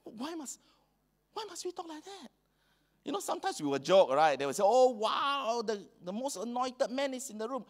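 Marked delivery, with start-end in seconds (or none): 0.0-2.3 pleading question. Real, not acted. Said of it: "pleading rhetorical question". 4.7-7.6 mock awed, pitched higher; sarcastic praise. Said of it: "some people are sarcastic".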